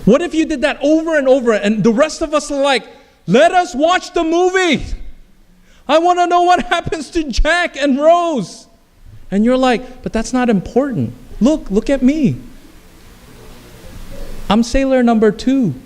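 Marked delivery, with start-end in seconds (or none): 3.3-8.7 high-pitched, strained-pitch plea; hammed-up outburst of a fed-up crowd; exasperated protest. Movie audience begs actor to stop pausing, exasperated. 10.0-15.7 pitched-up, mildly hammed-up boasting; insistent self-importance. Sailor argues with annoyed audience, insistent. Proud actor insists on attention.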